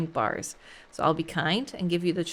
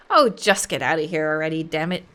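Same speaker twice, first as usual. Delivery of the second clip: angry dismissal; 4 semitones lower, 5 dB louder than usual. Acted. Inspector throws Moore out.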